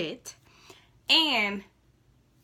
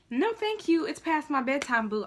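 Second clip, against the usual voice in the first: much higher, sweetly dismissive "no, thank you"; sassy brush-off; 7 semitones higher than usual. Retold or imitated. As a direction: brush him off with fake sweetness. Turning down the movie invitation.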